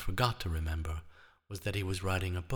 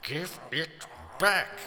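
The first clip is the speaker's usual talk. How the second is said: slow, high gurgling plea; gurgled; 8 semitones higher, slower than usual. Acted. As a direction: gurgle it out, strained. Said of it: "the Frenchman gurgled".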